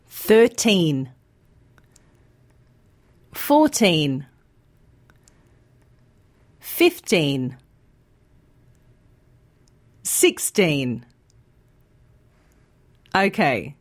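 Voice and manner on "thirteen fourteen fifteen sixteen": put-on thirteen fourteen fifteen sixteen, each with a long clear teen; stress on teen. Shown as a demonstration. Teacher modelling teen numbers for learners, long teen. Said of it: "say these words with me".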